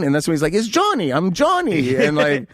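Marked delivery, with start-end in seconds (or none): 0.5-1.9 high-pitched imitation of the buyer; insistent buyer.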